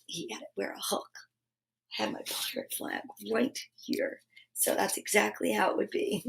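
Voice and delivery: hushed quick aside; whispered aside. Real, not acted. An aside about her pirate flag, hushed.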